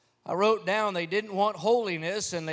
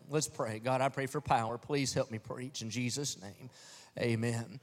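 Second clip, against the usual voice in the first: soft, low-voiced prayer; quiet prayer; 8 semitones lower, 8 dB quieter than usual. Real, not acted. Pastor praying for power before preaching, soft prayer voice. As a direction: pray humbly under the breath.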